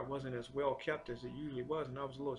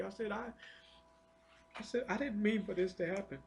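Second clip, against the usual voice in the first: tearful prayer; 8 semitones higher than usual. Retold or imitated.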